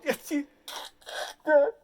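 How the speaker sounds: high, loud imitated blubbering; hammed-up high crying sound; mock sobbing wail. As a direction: wail like a weeping director. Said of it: "And he's crying".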